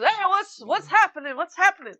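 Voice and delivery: high, bewildered captain calling out; confused captain. Acted. Captain to the crew, woken and baffled. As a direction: ask it like a just-woken captain in his pajamas. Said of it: "and he goes".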